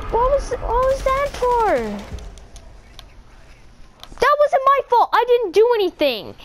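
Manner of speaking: high indignant protest. Real, not acted. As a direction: whine it's not my fault.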